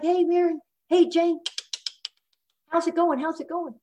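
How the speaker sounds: high-pitched mock hail-fellow greeting; put-on high, sociable hello; mock friendly greeter. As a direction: imitate a chummy regular working the room. Acting out husband greeting office staff.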